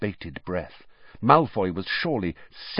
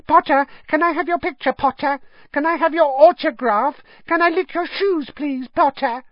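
much higher, fast, squeaky fanboy voice; mock an overeager little fan cruelly; bully mimicking a starstruck younger boy; Colin impression; 5 dB louder than usual. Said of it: "a cruel but accurate impression of Colin".

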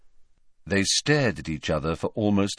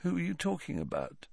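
soft, higher, confused question; quiet puzzlement; 7 semitones higher, 11 dB quieter, faster than usual. A narrator's reading. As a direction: ask softly, lost. Ron blinks, puzzled by Harry.

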